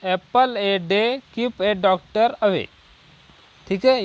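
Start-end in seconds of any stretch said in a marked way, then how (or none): 0.0-2.7 very slow, word by word dictation; slow example read. Shown as a demonstration. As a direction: read each word slowly for the class. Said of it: "example देखते हैं first". (धीमे-धीमे उदाहरण पढ़ना)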